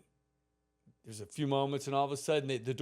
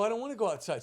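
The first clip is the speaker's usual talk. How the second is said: higher-pitched retold protest; mock child protest; 7 semitones higher, much faster than usual. Boy objects to babysitter sending him outside, higher-pitched protest. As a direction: imitate a reluctant kid objecting.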